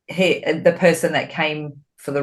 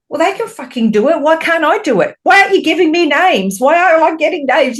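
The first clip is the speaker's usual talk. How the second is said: much higher and fast, mock frustrated whining; whiny complaint; 10 semitones higher, 5 dB louder, faster than usual. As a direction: rattle off the complaints like a sulky child. Mimicking her own frustrated complaint.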